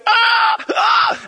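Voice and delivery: very high, loud eagle shriek; screaming eagle screech. Acted. Preacher imitating the Spirit as an eagle, piercing screech. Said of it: "like a screaming eagle".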